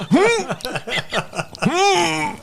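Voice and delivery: very high questioning hums, laughing; dad reacting to a toddler's rude-sounding word; amused surprise. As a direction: raise an eyebrow in mock shock. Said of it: "And I was like".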